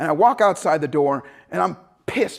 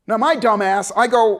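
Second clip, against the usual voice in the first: normal talking voice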